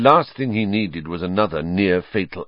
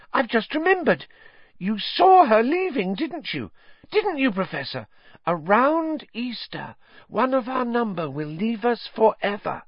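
very high, breathless awed gushing; gushing excitement; 5 dB louder than usual. Acted. Lavender gushing to Trelawney, high excited. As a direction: gush to your idol teacher.

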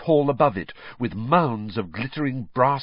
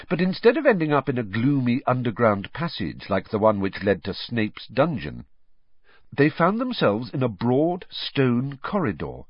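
ordinary delivery, nothing marked

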